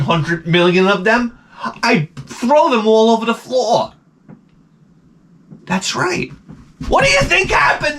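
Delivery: high, rising sarcastic tirade ending in a shout; sarcastic rant. Retold or imitated. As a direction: tear into the gawkers mockingly.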